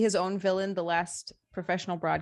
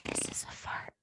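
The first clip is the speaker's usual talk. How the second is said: soft breathy ASMR whisper; 11 dB quieter than usual. Pretend. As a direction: whisper it right into the mic. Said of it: "you should do A S M R".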